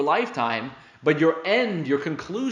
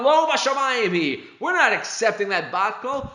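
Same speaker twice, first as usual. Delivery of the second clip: retold scream; 6 semitones higher than usual. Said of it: "he screamed out".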